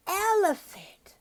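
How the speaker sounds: "Elephant" high and drawn out; teaching the word. Shown as a demonstration. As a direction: stretch "Elephant" slowly in a high voice for a child. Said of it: "then I underline the word elephant".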